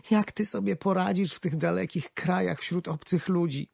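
wife anxious about the trip; worried wife. A narrator's reading. Wife voiced worried at station.

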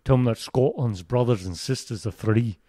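rousing Scottish burr; Scottish accent. Shown as a demonstration. Guest sending a message to England, Scottish accent. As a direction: declare it as a proud Scot.

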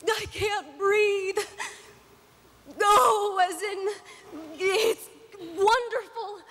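high, slow, tearful gush; crying. Acted. Wife moved by her husband's play, crying happily. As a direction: sob it out, overwhelmed and drawn out. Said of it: "Are you crying?".

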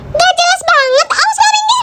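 high, irritated snap; annoyed. Acted. Rival ordering Sisi out of the way, annoyed. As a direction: brush someone off in a high, cross voice.

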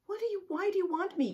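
high, fast squeaky question from the caught leprechaun; startled leprechaun. Acted. Leprechaun asking the cat who caught him, high and fast. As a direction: squeak it out, flustered. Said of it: "Clover asks".

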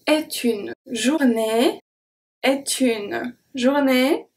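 slow est une journée with the T carried onto une; liaison shown. Shown as a demonstration. Teacher demonstrating the liaison slowly. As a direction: link the T of est into une, unhurried. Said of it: "The T from est is going to be transferred to une".

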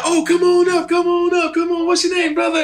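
high, ringing call to the stage; hollering host. Retold or imitated. Club host calling a newcomer up, hollered welcome.

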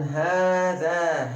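hâzâ said slowly, both a's long; teacher's model word; slow careful hâzâ. Shown as a demonstration. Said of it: "Bakın".

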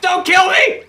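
high, loud, urgent begging; panicked pleading. Real, not acted. Player begging an opponent not to kill, panicked. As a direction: yell for your life. About to be killed.

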